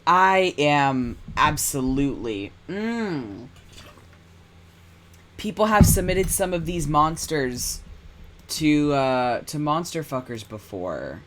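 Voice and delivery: normal talking voice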